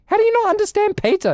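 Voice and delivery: can't believe anyone gets pizza wrong; incredulous. Real, not acted. Streamer ranting at reviewers, incredulous.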